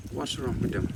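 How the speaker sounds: usual speaking voice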